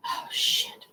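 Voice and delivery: breathy panicked whisper; whispered curse. Real, not acted.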